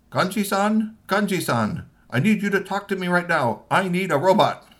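high, loud urgent call; urgent boss. Acted. Boss calling ninja chief, high urgent demands. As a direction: demand it down the phone. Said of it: "and said".

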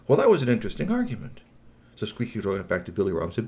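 usual speaking voice

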